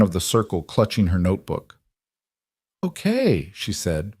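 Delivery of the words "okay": wobbly voice. A narrator's reading. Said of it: "voice wobbly".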